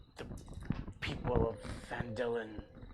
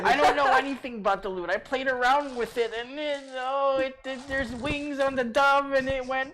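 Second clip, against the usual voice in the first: high, fast, whiny goblin babble; pleading goblin voice; 10 semitones higher, 7 dB louder than usual. Acted. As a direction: whine and beg, talking fast.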